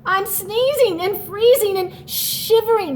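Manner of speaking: sneezing, freezing snowman. Acted. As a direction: whine out the cold like a snowman. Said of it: "The snowman sneezed a gigantic achoo".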